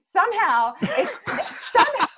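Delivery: high hag voice, breaking into laughter; accidental Hagacorn voice. Acted. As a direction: slip into the hag voice by mistake, laughing. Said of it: "I am now doing a Hagacorn voice".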